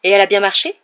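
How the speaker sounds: loud, eager, rising question; keenly interested. A narrator's reading. Father asking sons about the car, keenly interested. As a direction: burst out with curiosity. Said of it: "très intéressé".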